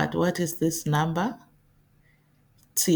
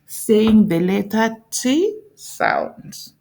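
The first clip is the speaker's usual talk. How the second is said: "T" stretched and lifted slowly; slow stress on T; 3 semitones higher, 4 dB louder than usual. Shown as a demonstration. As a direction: lean on "T", slow and clear. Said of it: "more practice".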